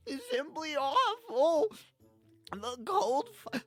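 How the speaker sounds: high, loud, slow sobbing elf; cracking wail. A narrator's reading. Elf reports the cold fire missing, wailing brokenly. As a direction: choke out each phrase between hammed-up sobs. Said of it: "Elrondo wailed, his voice cracking".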